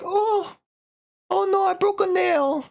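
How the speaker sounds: very high squeal over a trifle; hammed-up girlish fuss; mock dainty dismay. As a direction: whine it in a put-on high voice. Dainty dismay after killing doctor.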